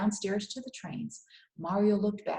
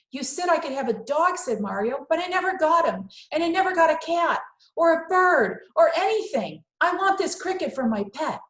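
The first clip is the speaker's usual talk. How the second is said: put-on loud, much higher pleading boy; pleading Mario; 9 semitones higher, 8 dB louder than usual. Mario begging his parents to keep the cricket, loud and high. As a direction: beg for the pet he never got.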